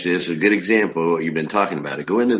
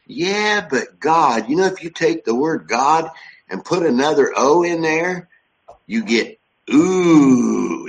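put-on higher, drawn-out old man with a long ooh; old guy's voice; 6 semitones higher, 3 dB louder, slower than usual. Old restaurant owner musing about God to [name], higher and drawn out. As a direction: lightly play the old guy, stretching the ooh very slow. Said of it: "the old guy looks at me and says".

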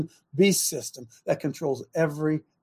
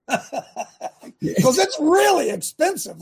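laughing; 10 semitones higher, much slower than usual. Real, not acted. Laughing about costly medicine.

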